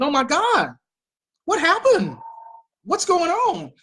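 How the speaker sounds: put-on worried disbelief; mock alarm. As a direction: react as if scandalized, tongue in cheek. Mocking reaction to the quoted tweet.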